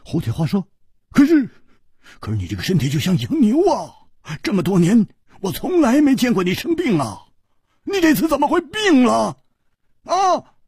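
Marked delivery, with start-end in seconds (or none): 1.1-10.6 high, loud, fast pleading; alarmed Hu Tiehua. Acted. Hu Tiehua, shocked his strong friend is ill, alarmed. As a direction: blurt it out in rising alarm.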